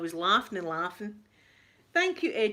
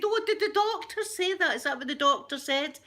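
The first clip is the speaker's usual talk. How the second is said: much higher, knowingly incredulous; teasing fake astonishment; mock surprise; 9 semitones higher than usual. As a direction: play along as if you believe her. Mother mocking daughter's claim.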